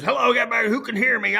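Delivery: imitating a director hailing his crew; imitated truck director's call. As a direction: call out high, hoping someone hears you. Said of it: "he's screaming in the truck".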